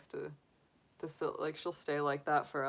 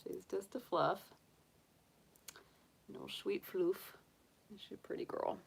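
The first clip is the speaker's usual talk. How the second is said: soft cooing; 4 semitones higher, 7 dB quieter than usual. Real, not acted. Cooing softly to rabbit.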